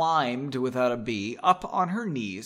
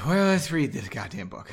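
usual speaking voice

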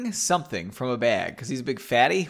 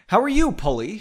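higher, lightly cheerful greeting; 6 semitones higher, 4 dB louder than usual. A narrator's reading. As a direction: ask it with good cheer. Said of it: "she asked cheerfully".

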